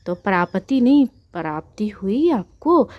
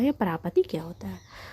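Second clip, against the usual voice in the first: a hushed, wondering whisper; puzzled whisper; 7 dB quieter than usual. Shown as a demonstration. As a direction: whisper it as if something is off. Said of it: "कुछ खटक सा रहा है". (उलझन भरी फुसफुसाहट)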